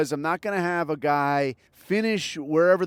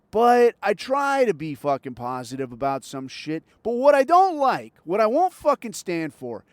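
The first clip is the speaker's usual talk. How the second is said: ordinary talk, nothing marked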